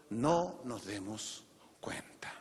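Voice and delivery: slow, low hushed whisper. Real, not acted. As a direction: drop to a soft whisper. Sermon point drops to hush.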